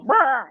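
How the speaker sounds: loud, high imitated squawk; chicken squawk. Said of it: "And he'd go".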